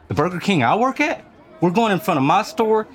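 mildly alarmed, rising questions; incredulous. Retold or imitated. Worker questioning organizers about marching past his own store, incredulous.

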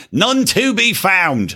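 put-on high, slow British accent, like a kids' show presenter. Host redoes his line like kids' show Steve, British accent. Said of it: "Do it like Steve on Steve and Maggie".

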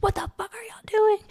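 high, sharp imitation of a friend snapping at them; friend yelling. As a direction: stop the fight like a parent would. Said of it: "Fonzie never yells".

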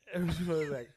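ordinary delivery

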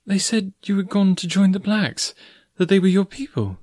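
high-pitched woman's voice; earnest young heroine. Acted. Girl explains to the forest man why she left, earnestly. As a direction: plead your case in a high voice.

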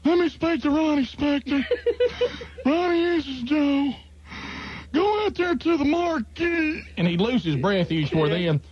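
prank-calling the manager as the owner; Joe impression. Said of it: "I began to be able to do this impression so well".

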